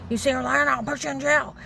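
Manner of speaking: high, loud re-enacted threat; retold screaming threat. Man threatening the speaker with jail, screaming. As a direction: shout it as his angry threat. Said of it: "He starts screaming".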